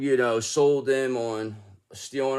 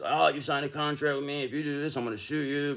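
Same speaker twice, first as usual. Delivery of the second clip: a higher, altered voice, smug and threatening; mock threatening boss; 4 semitones higher than usual. Halverson threatening the man with a lawsuit, mock smug. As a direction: lightly play the man waving a contract. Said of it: "He's gonna do".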